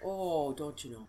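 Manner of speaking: put-on Minnesota accent. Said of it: "a bad Minnesotan accent".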